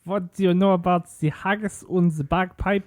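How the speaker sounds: high-pitched hammed-up German accent; mock a German trying to sound Scottish; German-tinged Scottish attempt. Joining in on German friends' Scottish attempts, hammed-up German accent. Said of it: "still let the German go through".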